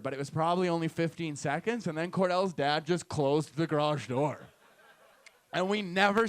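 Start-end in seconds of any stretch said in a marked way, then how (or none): none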